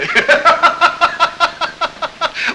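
loud high guffaw; high mocking laugh. Colonel laughing at Beckmann's tale, loud mocking guffaw. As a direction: laugh him off as a clown.

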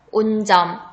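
spelling test read; dictating. Shown as a demonstration. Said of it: "문제는 딱 두 번 들려줄 테니까 잘 듣고".

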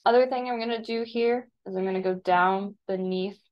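normal talking voice

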